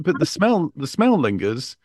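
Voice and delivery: normal talking voice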